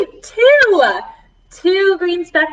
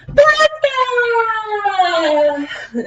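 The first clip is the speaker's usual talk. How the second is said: excited blast off; 9 semitones higher than usual. Acted. Teacher shouting the liftoff for kids. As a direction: launch the rocket with a shout.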